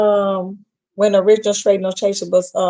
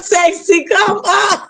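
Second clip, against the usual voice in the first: high laughing cry; laughing shout; 10 semitones higher, slower than usual. Real, not acted. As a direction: shriek it while laughing. Laughing at a viewer name.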